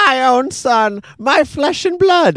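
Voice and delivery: squeaky hammed-up pappy tenderness; pappy moved. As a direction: play the father melting over his son. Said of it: "He goes".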